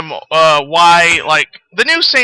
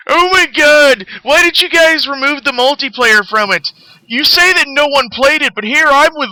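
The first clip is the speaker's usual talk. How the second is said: high, whiny mock of an entitled fan; mocking whiny gamer; 7 semitones higher than usual. Mocking the forum guy who rants about multiplayer, high whiny mock voice. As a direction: mimic him as a spoiled complainer, pitched up. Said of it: "this is the kind of shit that he says".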